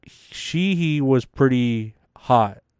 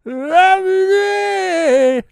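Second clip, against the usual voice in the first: very high, strained falsetto wail, slow and drawn out; hammed-up high crying croon, yelling boy; 4 dB louder, slower than usual. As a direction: caricature a broken singing voice, squealing and sobbing. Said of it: "That's how he sounds now".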